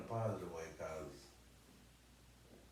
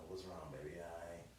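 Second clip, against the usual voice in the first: soft comforting; 2 semitones lower, 6 dB quieter than usual. Retold or imitated. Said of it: "could come in there, you know".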